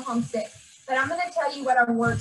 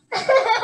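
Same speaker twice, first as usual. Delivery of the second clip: a teary real laugh, higher and louder; laughing through tears; 10 semitones higher, 6 dB louder than usual. Real, not acted. Teacher laughs through tears at her pupil, higher and louder.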